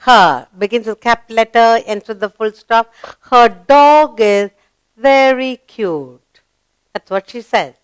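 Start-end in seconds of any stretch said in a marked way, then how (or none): 3.3-6.4 put-on very slow, deliberate reading for children; slow read-aloud. Shown as a demonstration. Teacher reading the sentence very slowly to children. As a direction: stretch every word out for the class. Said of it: "Read the sentence".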